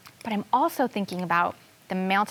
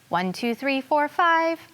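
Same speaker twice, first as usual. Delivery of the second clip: counting up the steps; 5 semitones higher than usual. Acted. Child narrator counts while running up her steps, rising excited count. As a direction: pitch climbing with each number. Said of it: "I run up the steps like this".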